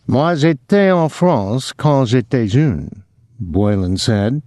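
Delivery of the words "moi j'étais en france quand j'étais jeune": put-on higher, slow French with a rasping accent. A narrator's reading. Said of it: "the accent rasping".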